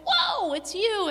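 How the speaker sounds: high, slow awed whoa; amazed. Acted. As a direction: breathe it in wonder. Peter meets risen Jesus.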